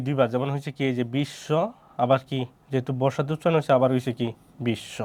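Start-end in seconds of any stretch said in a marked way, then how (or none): 1.2-1.5 "বিশ্ব" split as bish-sho, sh held double; doubled consonant. Shown as a demonstration. Teacher explaining the doubling rule, "বিশ্ব" with doubled sh. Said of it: "ওই ব্যঞ্জন বর্ণটাই হবে কি?". 4.7-5.0 "বিশ্ব" said softly as bish-sho, sh doubled; doubled consonant. Shown as a demonstration. Teacher repeating the example for students, "বিশ্ব" with doubled sh. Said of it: "দুইটা তালব্য স হয়েছে".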